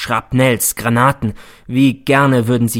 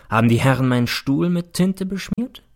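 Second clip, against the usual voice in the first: plain reading voice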